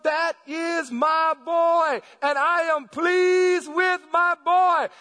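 slow, loud, high proclamation from God; booming proud announcement. Acted. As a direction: announce your son to all heaven, stretching the words. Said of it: "he made the announcement".

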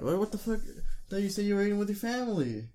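pitched high, disbelieving what the fuck; playfully accusing, voice shooting up; mock outrage. Real, not acted. Friend spots McDonald's in the trash, shocked and accusing.

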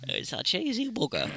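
lightly mocked northern English; mock northern accent. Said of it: "Your mum's not northern".

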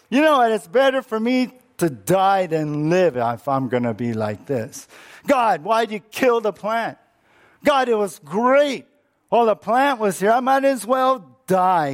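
high, whiny prophet begging to die; Jonah's pouting complaint. Acted. Preacher voicing Jonah pouting at God over the plant. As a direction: pout it out like a sulking child.

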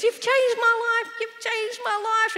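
very high, overwrought mock crying voice; imitated tearful outburst. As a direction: cry it out as the overcome man. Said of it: "he's just crying and crying".

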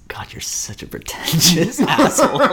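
laughing through a teasing insult; giggly whispered jab. Real, not acted.

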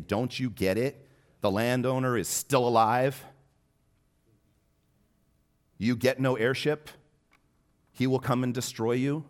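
high, loud, hammering rebuke; stern confrontation. Acted. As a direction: confront them with rising force, slowing at the end. Jesus confronting the leaders.

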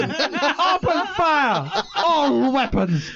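high, drawn-out film command; mock villain battle order. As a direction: order the attack like a hammed-up villain.

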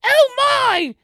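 very high, loud fake British voice; hammed-up mock-British squawk, far above your own pitch; bad British accent. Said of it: "not a British person doing his accent".

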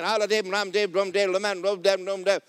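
high, rhythmic rattle of syllables; praying in tongues. Acted. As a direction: chant it insistently, without a break. Resumes acted praying in tongues.

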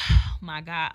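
loud sigh into exasperated words; re-enacted exasperated "oh my God"; retold irritation. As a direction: let out a big fed-up sigh. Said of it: "I'm just so irritated".

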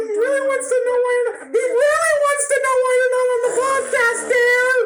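insistent pleading. Acted. Mother pushing father to explain, high and loud. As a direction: press harder, rising to a loud plea.

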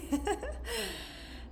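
bright high-pitched laugh. Real, not acted. Laughs after quoting husband. (éclat de rire aigu)

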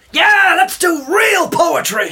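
slow, loud and fired up; rallying call. Acted. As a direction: rouse the whole room. Poet rallies the class.

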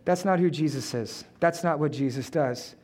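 normal talking voice

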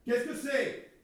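high-pitched shouted question; crying out. Acted. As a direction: yell the question to another room. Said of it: "il a crié".

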